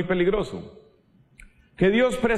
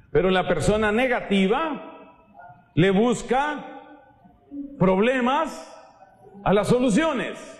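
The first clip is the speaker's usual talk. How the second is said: le busca lento, problemas resaltado; énfasis en juego de palabras; 5 semitones higher than usual. Shown as a demonstration. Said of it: "juego de palabras". (invertir problemas y soluciones con énfasis)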